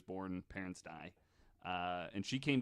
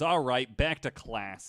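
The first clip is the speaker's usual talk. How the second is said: dismissive priest; 3 semitones higher, 9 dB louder than usual. Retold or imitated. Voicing dismissive Father Erasmus.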